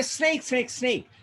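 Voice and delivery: high, mock-startled cry without extra volume; acted alarm. You mistake a rope for a snake, mock alarm. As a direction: lightly act a startled warning, pitched high.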